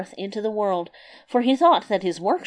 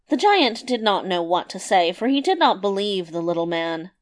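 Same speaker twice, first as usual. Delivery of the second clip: usual speaking voice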